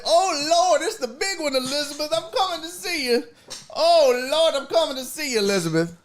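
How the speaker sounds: mock heart attack. Host playing Jerry faking a heart attack, high hammed-up old man. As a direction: fake the big one, calling to Elizabeth. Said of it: "He'd be over there".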